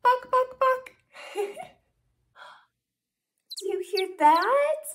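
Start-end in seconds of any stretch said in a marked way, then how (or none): 0.0-0.9 high-pitched short clucks; three little high BUCKs; chicken clucks. Acted. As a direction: imitate a hen clucking. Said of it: "A chicken says".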